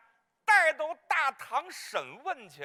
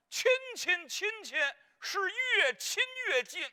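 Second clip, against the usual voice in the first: mock tearful; 7 semitones higher than usual. Tearful family reunion retold.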